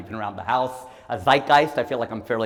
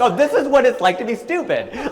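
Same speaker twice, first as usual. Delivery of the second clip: much higher, louder, laughing through the discovery; laughing realization; 12 semitones higher, 8 dB louder than usual. Retold or imitated. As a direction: realize it out loud with a big laugh. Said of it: "I'm like".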